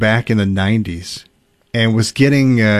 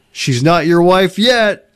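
slow, higher-pitched mock retort; best man's retort; 7 semitones higher, slower than usual. Best man answers the upset groom, slow acted retort. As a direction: draw it out as a pointed comeback. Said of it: "the best man answered".

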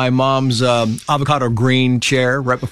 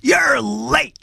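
high, strained drill-coach holler; imitated coach's shout; 12 semitones higher, much slower than usual. Coach hollering at a late young player, imitated shout. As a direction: call it across a field, stretching the words. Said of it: "he was gonna be screaming at me".

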